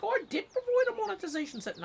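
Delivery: squeaky fast exasperated mock protest. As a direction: whine back at the website. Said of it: "I'm like".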